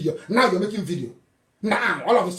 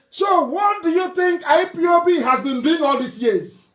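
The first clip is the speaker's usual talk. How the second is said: angry shout; 7 semitones higher than usual. Real, not acted. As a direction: hurl the question at them. Angry rhetorical challenge.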